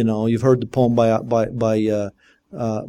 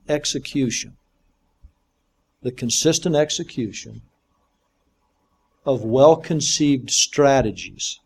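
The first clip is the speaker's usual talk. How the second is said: dictating. Shown as a demonstration. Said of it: "someone read that back to me to make sure you got it".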